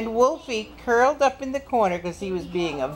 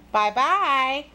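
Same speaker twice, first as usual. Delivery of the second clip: put-on higher, lilting little-girl goodbye; little girl's farewell; 4 semitones higher than usual. Girl takes leave of wolf.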